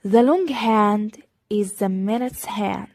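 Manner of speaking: slow, clear teacher demo; deliberate classroom pacing; slow model reading. Shown as a demonstration. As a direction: spell it out slowly for learners. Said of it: "teacher models English slowly".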